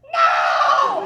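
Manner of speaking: high, drawn-out comic shriek; scream. Retold or imitated. Weird anesthesiologist hearing about an orange, scream. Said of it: "She screams again".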